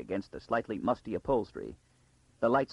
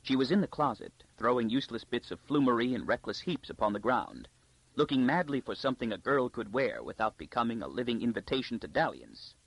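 usual speaking voice

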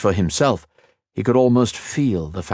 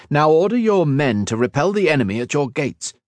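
commanding; 7 semitones higher, 3 dB louder than usual. A narrator's reading. Commander orders the captain into battle.

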